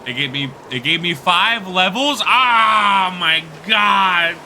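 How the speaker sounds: loud, high, stunned yelling; shocked shouting. Real, not acted. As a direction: yell out in disbelief. Shocked by five levels.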